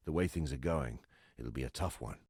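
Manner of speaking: ordinary talk, nothing marked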